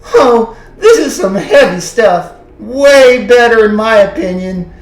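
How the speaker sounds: emotional outburst. Real, not acted. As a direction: voice jumps high and loud. Emotional outburst about dying father.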